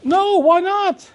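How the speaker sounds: high, hammed-up excited cheer; mock excited pride. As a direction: play it like a realtor hungry for applause. Imagined proud realtor, excited high.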